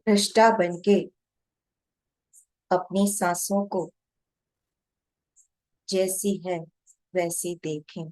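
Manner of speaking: measured meditation guide, long pauses between short phrases; guided meditation. Real, not acted. Guide to meditators watching their breath, calm guided meditation. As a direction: guide them unhurriedly, phrase by phrase, leaving long silences.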